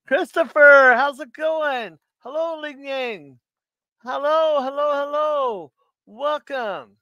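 high, drawn-out hellos; singsong greeting. Real, not acted. Streamer greeting viewers as they come in, singsong welcome. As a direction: call out a cheery, high-pitched welcome.

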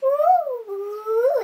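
high, loud whoop; excited whoop. Pretend. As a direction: let out a loopy party whoop. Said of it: "the Sudafed dance. Are you ready?".

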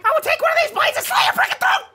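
losing it at the teammate who keeps saying Carl; furious threat. Acted. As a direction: explode with a murderous threat. Threatening the teammate who mocks him.

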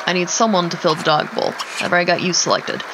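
usual speaking voice

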